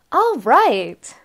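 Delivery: loud call over arguing. A narrator's reading. Barber calling over the family's argument, loud. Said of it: "I called out".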